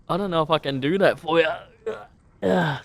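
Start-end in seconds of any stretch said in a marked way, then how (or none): none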